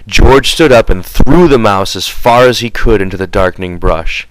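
ordinary delivery, nothing marked

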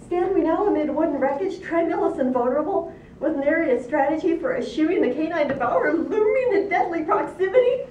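high, loud alarmed pig; squealing pig. A narrator's reading. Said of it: "squealed two".

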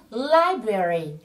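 LIBRARY slowly, stress on the first syllable. Shown as a demonstration. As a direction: lean on the start of "Library", drawn out. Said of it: "trọng âm rơi vào âm tiết đầu tiên". (nhấn âm tiết đầu của "Library", đọc chậm)

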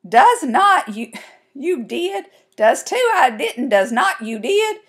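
high, loud back-and-forth bickering; kids' squabble. A narrator's reading. Two sisters trading denials over the tooth, bickering. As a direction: bat the retorts back quickly in singsong. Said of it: "Kelsey Ann giggled".

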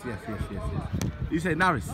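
ordinary talk, nothing marked

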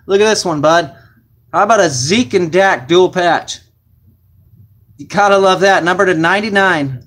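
hyping up a big card reveal; excited. Real, not acted. Card breaker to viewers over a Zeke and Dak patch, excited.

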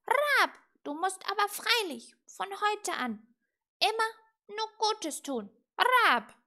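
loud, high, insistent squawk; croaking raven. A narrator's reading. Raven advising witch to do good, insistent croak. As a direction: croak the advice between piercing caws. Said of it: "krächzte Apraxas".

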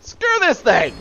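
high, loud burst of frustration; frustrated outburst. Real, not acted. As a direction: shout it high in exasperation.

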